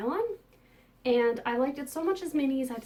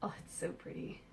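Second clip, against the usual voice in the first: whispering; 3 semitones lower, 10 dB quieter than usual. Real, not acted. Admiring yarn up close.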